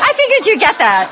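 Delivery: chuckling, pitch lifted; amused at her own joke; laughing. Real, not acted. Laughing after her pun.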